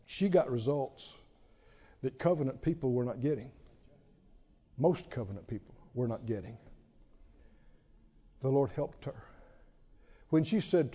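soft, low, unhurried sermon; quiet preaching. Acted. Preacher telling the humble woman's story, quietly. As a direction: draw the congregation in softly.

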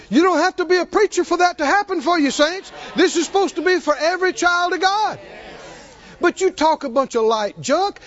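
fervent preaching. Real, not acted. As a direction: preach it up to the saints with rising fire.

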